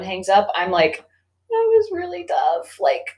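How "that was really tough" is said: high, drawn-out mock teary voice; retold breakdown. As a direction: play at losing it, voice pitched right up. Breaks once phone hangs up.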